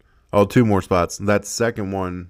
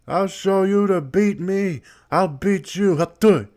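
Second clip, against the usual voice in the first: higher, louder mock foreign wrestler taunt; hammed-up Iron Sheik taunt; 10 semitones higher, 5 dB louder than usual. Player voicing his wrestler, taunting the opponent, in a high loud mock voice. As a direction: puff up and threaten like a cartoon heel.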